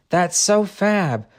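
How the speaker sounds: delighted. Acted. Lizzie delighted by eight days.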